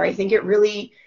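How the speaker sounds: ordinary delivery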